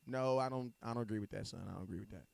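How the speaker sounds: low, very soft retold objection; quietly refuse, as mom would; imitating disapproving mom. Voicing mother's quiet disapproval.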